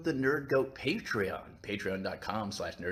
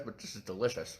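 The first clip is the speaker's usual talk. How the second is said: chewing noisily; 2 semitones lower, 5 dB quieter than usual. Real, not acted. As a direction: mouth full of pancake. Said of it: "I'm sorry I'm eating from you guys".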